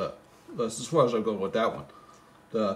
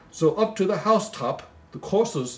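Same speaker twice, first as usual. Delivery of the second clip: poem recital; 7 semitones higher than usual. Acted. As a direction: read it like a bedtime Christmas poem. Reading the poem aloud.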